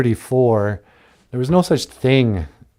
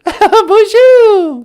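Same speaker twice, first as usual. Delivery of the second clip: laughing bonjour; 8 dB louder than usual. Real, not acted. Laughing French greeting.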